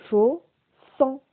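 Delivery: "Chaud, sang" as two separated syllables of one word. Shown as a demonstration. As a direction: sound out "Chaud, sang" with a pause between. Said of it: "dans la première syllabe". (« Chaud, sang » détaché syllabe par syllabe)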